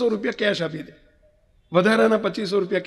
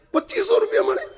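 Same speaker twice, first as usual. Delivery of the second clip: a very high, incredulous question; astonished child; 12 semitones higher than usual. Retold or imitated. Son disbelieving the overtime pay, high incredulous child voice. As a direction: gasp it out like a shocked little boy. Child shocked at the small sum.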